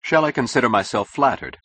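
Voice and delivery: lively, flattered cordiality; brightly. A narrator's reading. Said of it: "he said brightly".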